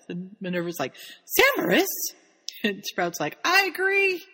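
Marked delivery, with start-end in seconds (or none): 1.2-1.9 high, loud one-word rebuke of surprise; mock-scandalised, pitched way up; retold shocked exclamation. 3.4-4.2 high, emphatic agreement; Sprout chiming in. Retold or imitated.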